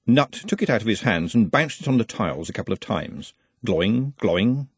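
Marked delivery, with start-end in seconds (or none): none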